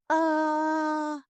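sustained high-pitched "aaah"; falling scream. A narrator's reading. Heroine falling into a hole, screaming. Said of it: "закричала я".

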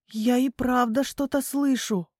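usual speaking voice

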